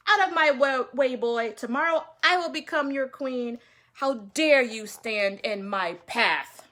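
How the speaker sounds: loud, arrogant scolding; angry haughty shout. Acted. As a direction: snap at him like a spoiled queen-to-be. Manyara scolds the boy.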